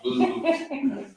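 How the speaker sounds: laughing it out, pitch jumping high; slow, amused aside through laughter; giggling punchline about the podium. Real, not acted. Joking about the moved podium.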